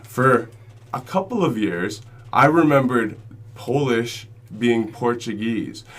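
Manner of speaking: ordinary talk, nothing marked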